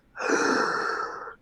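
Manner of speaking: sharp, long gasp in; forced, audible inhale; forceful deep breath. Acted. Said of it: "forcefully take in a deep breath".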